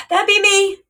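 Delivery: high, eager "That'd be me"; jumping at the chance to be rescued; excited relief. Retold or imitated. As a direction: pitch it up, bursting with relief. Relieved, spotting the security car.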